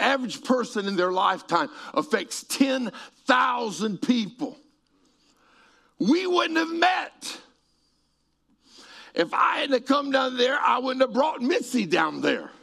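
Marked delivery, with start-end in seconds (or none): 6.0-12.5 preaching shout. Real, not acted. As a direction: drive it home to the crowd at full force.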